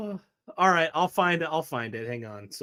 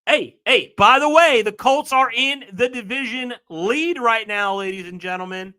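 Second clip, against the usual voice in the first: loud announcing; 5 semitones higher, 6 dB louder than usual. Real, not acted. Announcing standings to viewers.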